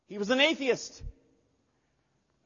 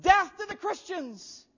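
higher, slow mob chant of hatred; crowd yelling; 10 semitones higher, slower than usual. Retold or imitated. Stadium crowd to the Christians, a slow higher mock yell. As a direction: bellow it like a baying mob. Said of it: "they yelled out".

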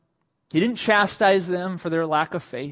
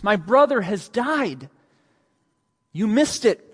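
higher, louder grieving complaint; grieving Martha; 7 semitones higher, 6 dB louder than usual. Acted. Martha telling Jesus her brother died, in a raised, grieving voice. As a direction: tell him he missed it.

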